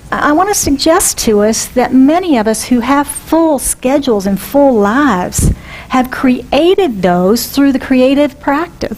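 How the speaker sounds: ordinary delivery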